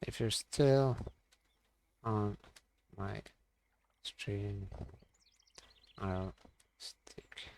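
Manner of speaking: very slow, word by word with long gaps; halting, softly trailing self-dictation; typing aloud. Real, not acted. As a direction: murmur it piecemeal while typing. Saying words slowly while typing them.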